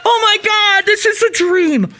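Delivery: high joyful shout, slowing down; ecstatic shouting. Real, not acted. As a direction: yell it like a fan's dream came true. Hears dream scene will happen.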